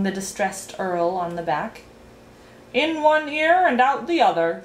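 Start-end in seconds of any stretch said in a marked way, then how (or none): 2.7-4.6 high-pitched, mildly acted telling-off; scolding. A narrator's reading. Duke scolding the distressed earl, a lightly acted telling-off. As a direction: scold him in a raised pitch. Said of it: "scolded the duke".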